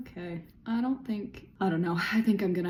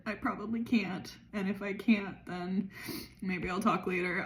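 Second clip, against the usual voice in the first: ordinary delivery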